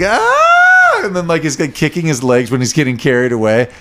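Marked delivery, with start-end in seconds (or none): 0.0-1.0 very high shrill mock scream. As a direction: let out a cartoon scream. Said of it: "screaming goes like".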